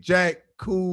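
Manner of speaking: a seventies cool cat saying it; mock old-school cool guy; seventies slang. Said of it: "his terminology was still stuck in nineteen seventy".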